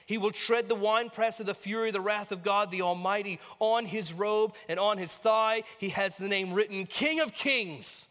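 forceful scripture reading. Acted. Preacher reading Revelation to the congregation, triumphant proclamation. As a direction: declaim it grandly, building in force.

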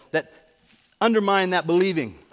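ordinary talk, nothing marked

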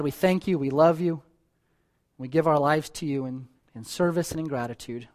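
pastor closing the sermon in prayer. Real, not acted.

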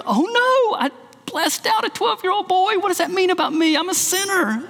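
high-pitched mock dismay; dismayed realisation. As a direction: act out a mother aghast at herself. Mother's sudden remorse, acted out.

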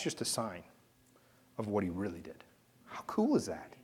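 whispered, low and breathy with awe; awed sermon whisper; hushed whisper. Real, not acted. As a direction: drop to a whisper as if sharing a secret.

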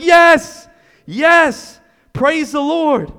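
high, loud, slow cry of relief; relieved praise. Acted. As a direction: burst out in joyful relief. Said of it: "And you're like".

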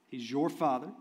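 ordinary talk

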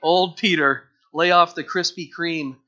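loud, mocking brag, slow on the first words; teasing victory gloat. John teasing Peter after winning the race, loud and gloating. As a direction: rub it in to the loser. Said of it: "That's what he's saying".

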